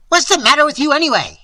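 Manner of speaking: high, fast, furious bawl; loud exasperated outburst. A narrator's reading. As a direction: bawl it at him in a rage. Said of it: "he bawled".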